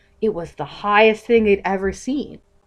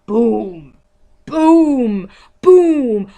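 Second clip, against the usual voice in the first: building booms, louder each time; booming footsteps; 2 semitones higher, 6 dB louder, much slower than usual. A narrator's reading. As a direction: stomp each boom bigger. Dada giant approaching footsteps.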